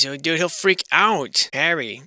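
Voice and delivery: normal talking voice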